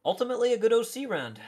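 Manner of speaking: high, loud round-end call; excited. Real, not acted. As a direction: shout the verdict with energy. Caster closes the round.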